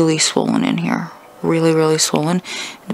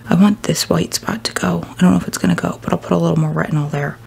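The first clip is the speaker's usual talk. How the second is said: ordinary talk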